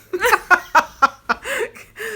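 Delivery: loud, high burst of laughing; real laughter. Real, not acted. Joking that New Zealand viewers would win, loud laughter. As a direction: crack up loudly.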